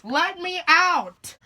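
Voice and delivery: trapped reviewer yelling slowly. Acted. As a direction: stretch out the demand to be freed. Demanding release again.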